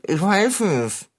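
slow, garbled speech through a slice of onion; onion in mouth. Acted. Cat answers mechanic with raw onion in mouth, garbled. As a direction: mumble thickly round a mouthful. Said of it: "sprichst du sehr undeutlich".

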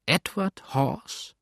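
incredulous, stretched question; astonished. A narrator's reading. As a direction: draw out the name in amazement. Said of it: "fragte Tom erstaunt".

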